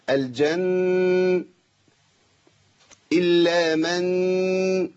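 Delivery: very slow level hum on the n of الجن and من; steady ghunnah. Shown as a demonstration. Teacher matching the timings, الجن and من hummed long. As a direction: hold الجن and من equally long. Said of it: "الزمن عندي لازم يبقى ثابت".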